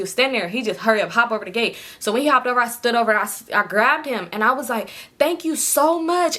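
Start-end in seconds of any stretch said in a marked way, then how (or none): 5.2-6.4 high, slow, gushing thank-you; thanking the stranger, high and drawn out. Retold or imitated. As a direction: gush at the boy you think saved you. Thanking her rescuer.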